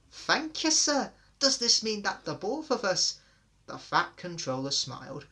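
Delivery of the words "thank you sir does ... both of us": very high, loud and thrilled; overjoyed. A narrator's reading. Twins thanking the fat controller, thrilled and high. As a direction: gush your thanks.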